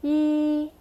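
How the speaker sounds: "Yi" held high and even; first tone. Shown as a demonstration. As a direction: sustain "Yi" on one level pitch. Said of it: "Make it nice and even".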